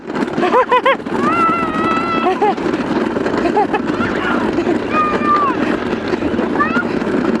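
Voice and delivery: high delighted laughing; real laughter. Real, not acted. Long laugh during the ride.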